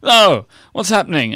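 loud, high mock dismay; retold confused alarm. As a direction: act out your bewildered panic. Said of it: "I was very confused".

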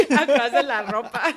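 laughing. Real, not acted. Laughs at hiding story.

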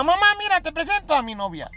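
proud son. Acted. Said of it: "le dijo a la mamá".

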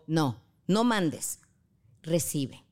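ordinary delivery

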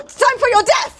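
mock threat. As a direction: announce doom theatrically. Acted duel threat.

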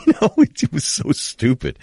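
usual speaking voice

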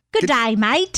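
put-on a go at an Australian accent; lightly put-on Australian accent. Co-host answers the number five accent, Australian accent.

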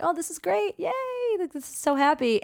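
high, sing-song mock delight; a high put-on giddy yay; mock bliss. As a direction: gush like a giddy inner voice.

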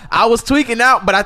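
ordinary delivery

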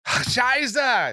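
high-pitched mock German outburst; exasperated German cursing. Acted frustrated cursing in German.

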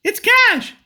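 squeaky high falsetto, played as his real voice; falsetto voice reveal. Cash reveals his real voice to TJ in a squeaky high falsetto. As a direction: reveal a tiny high voice with total sincerity. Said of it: "it's time for my voice reveal".

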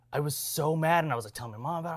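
usual speaking voice

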